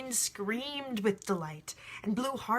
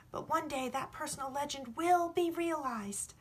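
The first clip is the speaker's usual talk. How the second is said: put-on higher, eager young shepherd explaining; young boy, earnest; 6 semitones higher than usual. The boy tells the sun about love, earnest and higher. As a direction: press the point as a sincere boy.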